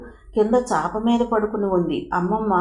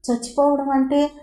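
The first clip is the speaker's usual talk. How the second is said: sad; 6 semitones higher than usual. A narrator's reading. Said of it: "దిగులుగా అడిగింది తను".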